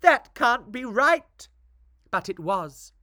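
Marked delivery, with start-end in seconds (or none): 0.0-1.5 dismayed. Acted. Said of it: "they all said".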